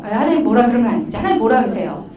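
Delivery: usual speaking voice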